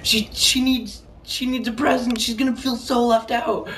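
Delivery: high-pitched mock panicky grandma; freaking-out grandma. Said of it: "she was freaking out".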